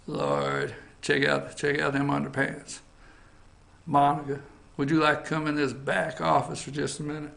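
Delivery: Bill Clinton impression. Host imitating Bill eyeing Monica, low sly mutter. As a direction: growl it low like a leering old boss. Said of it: "I'm gonna do my Bill Clinton impression".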